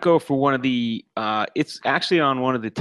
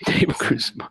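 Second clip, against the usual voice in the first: laughing. Real, not acted. Laughs at co-host's silly question.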